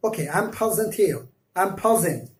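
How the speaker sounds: put-on English accent, प्रेजेंट with a puffed ph; English aspirated P. Teacher imitating how English speakers say present. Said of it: "प्रेजेंट को वो हमेशा बोलता है".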